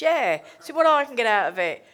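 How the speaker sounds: gleeful mock reply. To the worker, seeing what she can get, loud glee. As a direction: pounce on the idea greedily.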